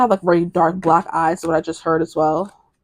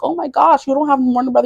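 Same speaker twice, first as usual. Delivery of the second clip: higher, amazed pretend voice of impressed people; mock amazed; 8 semitones higher than usual. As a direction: gush over it like an awed onlooker. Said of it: "People are like".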